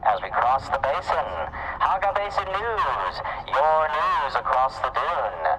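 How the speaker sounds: loud, high news promo voice; news announcer. Acted. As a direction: pitch the news like a radio promo. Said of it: "make some announcements".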